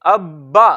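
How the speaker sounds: careful reading of अब्बा, bb held; doubled b held. Shown as a demonstration. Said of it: "बल्कि".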